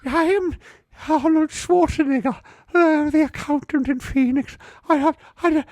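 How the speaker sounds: very high, slow, odd accountant voice; squeaky accountant from Phoenix; accountant impression. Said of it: "he sounds like this".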